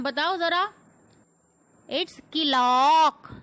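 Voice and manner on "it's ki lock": "clock" split as ki-laak, loud and stretched; wrong pronunciation. Shown as a demonstration. Teacher mimicking learners' mistake, loud drawn-out "clock" as ki-lock. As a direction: say "clock" with an extra i after the k. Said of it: "It's not clock".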